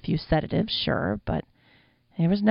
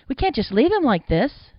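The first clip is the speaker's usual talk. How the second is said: high, loud plea; protesting; 8 semitones higher, 7 dB louder than usual. A narrator's reading. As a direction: object urgently, voice pitched up. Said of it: "Jack protested".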